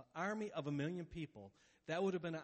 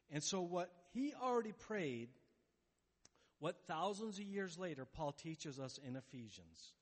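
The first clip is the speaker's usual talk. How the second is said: normal talking voice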